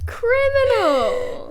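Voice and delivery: high, loud, drawn-out verdict; mock outrage. Real, not acted. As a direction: condemn the awful joke, stretching the word. Co-host condemns the bad joke.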